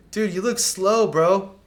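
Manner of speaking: teasing jab. Real, not acted. Coach teasing a slow player.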